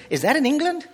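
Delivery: mock the clueless American asking about England; American accent. Preacher imitates American asking if Wales is in England. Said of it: "Almost every time".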